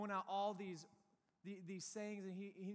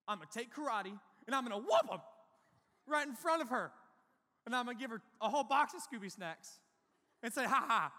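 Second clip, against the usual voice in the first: gleeful revenge plan; 4 semitones higher, 9 dB louder than usual. Retold or imitated. Plotting revenge, rising excitement.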